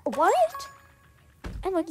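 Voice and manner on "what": much higher and louder what; mock disbelief, rising; outraged what. Narrator offended by the friend, loud disbelief.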